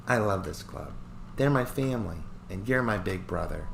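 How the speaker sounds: lightly voiced little sister, soft and fond; a young girl clinging to her big brother; sweet little sister.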